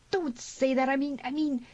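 high, flustered objection; flustered protest. A narrator's reading. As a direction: protest and fumble to explain. Harmony protests, flustered explanation.

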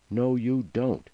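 forceful, slowed-down objection; firm refusal. A narrator's reading. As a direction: stand your ground, drawing out each word. Tina blocks agent from suitcase.

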